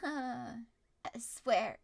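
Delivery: ordinary delivery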